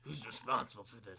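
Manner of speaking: low-pitched action-hero impression; deep, put-on movie-star voice; Keanu Reeves. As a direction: drop the pitch for a mock serious demand. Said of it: "I can't do his voice".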